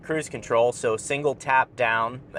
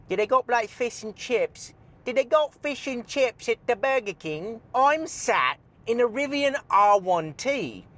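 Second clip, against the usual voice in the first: higher, hammed-up British accent; mock British accent; 9 semitones higher than usual. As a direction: play a silly Brit on telly. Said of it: "you gotta talk like this".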